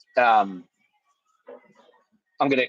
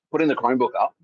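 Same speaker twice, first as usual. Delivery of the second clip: ordinary talk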